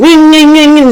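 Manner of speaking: high, loud repeated ringing; insistent high-pitched noise imitation; imitated little noise. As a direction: mimic a nagging ringing sound. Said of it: "little noise".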